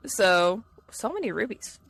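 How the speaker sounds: ordinary delivery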